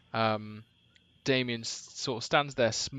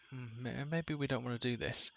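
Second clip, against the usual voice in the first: quiet, hesitant backing down; very unsure; 8 dB quieter than usual. Acted. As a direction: falter softly, as if losing nerve. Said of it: "very unsure of themselves".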